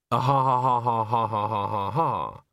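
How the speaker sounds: measured, spelled-out ha-ha-ha; reading written laughter. Acted. Bucket reading the ghost's note aloud, stilted read-out laughter. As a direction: recite the laughter syllable by syllable.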